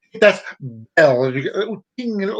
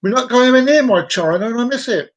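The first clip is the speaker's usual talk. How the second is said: put-on clumsy Chinese accent, pitched up; attempted Chinese accent; 7 semitones higher, 4 dB louder than usual. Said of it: "I can't do Chinese either".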